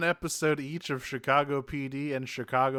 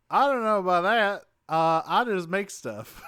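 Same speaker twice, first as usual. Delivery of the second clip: higher, louder imitated protest; demurring inventor; 6 semitones higher, 6 dB louder than usual. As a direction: back off in a raised character voice. Voicing Wheeljack's awkward refusal.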